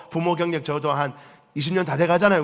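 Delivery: ordinary talk, nothing marked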